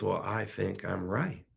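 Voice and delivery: low, soft and slow; quiet confidence. Retold or imitated. Rich man answers her in a quiet moment, quiet and sure. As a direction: say it quietly and unhurriedly, pitched low. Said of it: "In a quiet moment, he says".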